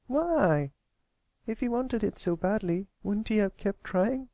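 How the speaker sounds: put-on a young filly asking anxiously; young filly Applebloom. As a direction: sound like a worried young pony. Applebloom questions him.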